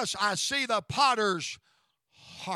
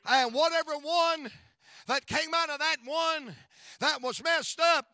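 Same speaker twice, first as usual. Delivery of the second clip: steady driving repeated lines, louder; preaching; 3 semitones higher, 4 dB louder than usual. Acted. Pastor assuring listeners that broken vessels are remade, fervent preaching. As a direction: drive each repeated line home.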